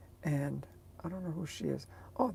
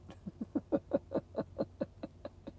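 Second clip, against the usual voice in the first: whispered giggle; 8 semitones higher, 4 dB quieter than usual. Real, not acted. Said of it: "giggle after whisper".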